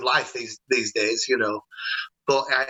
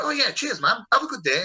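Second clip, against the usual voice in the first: imitating a shocked policeman, much higher; surprised thanks; 9 semitones higher than usual. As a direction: play the startled cop caught off guard, pitch jumping up. Shocked officer thanks him.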